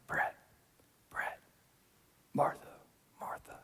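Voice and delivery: faint, barely voiced calling of names; still small voice. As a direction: whisper the names gently.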